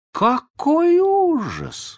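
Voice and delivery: высокий, очень медленный ужас; протянуть с изумлением; восклицание. Acted. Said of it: "воскликнула Венди". (Венди потрясена, что Питер не знает сказок)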